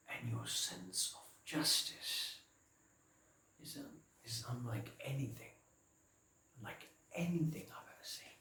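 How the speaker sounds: very soft, halting praise; hushed admiration. Acted. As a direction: confide it almost in a whisper. Confiding admiration.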